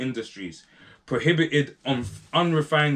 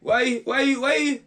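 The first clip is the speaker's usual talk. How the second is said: high, put-on sneering voice; a high mocking minister's whine; mocking the ministers; 12 semitones higher than usual. Ministers scoffing at the new president, high and mocking. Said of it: "some of these guys were thinking".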